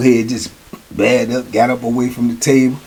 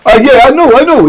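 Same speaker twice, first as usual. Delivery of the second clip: higher and louder, scornful; young boy scoffing; 9 semitones higher, 6 dB louder than usual. Retold or imitated. Young boy scoffing at the old timer, louder. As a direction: sneer it back at him. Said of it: "Dude say".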